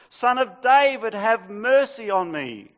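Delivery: high, loud desperate cry; top of his lungs. Acted. Beggar crying out over the rebuking crowd, loud. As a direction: cry it out at full volume. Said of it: "cries out all the more at the top of his lungs".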